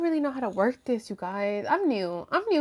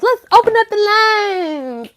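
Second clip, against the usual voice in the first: high, loud, drawn-out announcement; hammed-up host call; 10 semitones higher, 9 dB louder, slower than usual. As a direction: belt it out slowly like a hotline host. Repeats it as show host.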